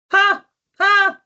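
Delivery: loud high "Ha! Ha!" breaking into a laugh; cracking up at the card; real burst of laughter. Real, not acted. Delighted by a funny card.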